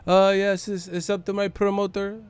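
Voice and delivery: put-on declining a bar invite in a Pacquiao accent; Pacquiao impression. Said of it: "I'll just be saying shit".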